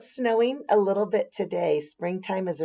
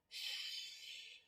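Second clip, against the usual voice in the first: soft unvoiced shush; hush sound; 25 dB quieter than usual. A narrator's reading. Narrator to children, shushing the sleeping garden. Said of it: "she tucks her garden in".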